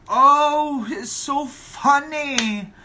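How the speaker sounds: high, drawn-out mock delight; loud "oh", then slow, exaggerated hilarity; sarcastic amusement. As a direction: gush sarcastically at a cheap joke. Mocking reply to a cheap joke.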